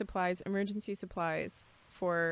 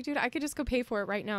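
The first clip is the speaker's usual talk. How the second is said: quick pleading; 5 semitones higher, faster than usual. Retold or imitated. Pleading with store staff.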